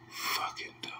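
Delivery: disgusted whisper under the breath; breathy scornful whisper; whispered aside. Real, not acted. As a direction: whisper it to yourself in contempt. Muttered aside after quote.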